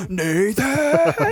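big roaring yell; Hulk-level yell. Retold or imitated. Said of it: "a Hulk level".

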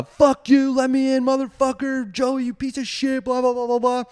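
high, mock-furious yelling; retold screaming. Storyteller imitating himself cursing his friend through door, retold screaming. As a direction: act out a tantrum at the door. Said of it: "I'm screaming".

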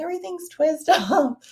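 high, slow mock amazement; surprised. Retelling their shock at twist-off wine bottles, high and slow. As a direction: act shocked at all the twist offs. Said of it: "we're like".

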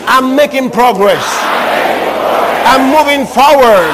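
slow, loud proclamation; booming declaration of faith; full-force preaching. Real, not acted. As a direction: drive each phrase out slowly and forcefully for the crowd to echo.

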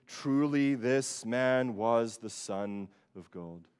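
slow, weighty reading of the confession; slow solemn quote. Retold or imitated. Quoting the centurion's confession slowly again. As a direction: let each word land slowly. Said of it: "the conclusion ... of the centurion".

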